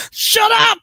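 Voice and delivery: high, loud exasperated yell; yelled shut up. Retold or imitated. Man fixing a VCR yells at the nagging parrot.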